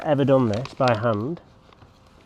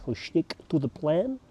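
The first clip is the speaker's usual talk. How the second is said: put-on a Ten Hag style Dutch accent, higher; 5 semitones higher, 5 dB quieter than usual. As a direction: say it like a Dutch football manager. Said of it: "Working on my Dutch accent".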